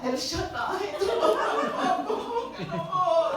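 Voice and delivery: high, laughing mock plea to God; mock desperate prayer. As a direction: ham up a panicked prayer through laughter. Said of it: "ang ala".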